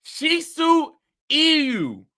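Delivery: ordinary delivery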